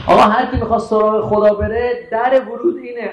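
ordinary talk

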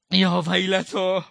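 loud, rising wail of a sister; keen it out in grief; grieving cry. Acted. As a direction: lament rising to a high sob. Zeinab's cry voiced in rowzeh.

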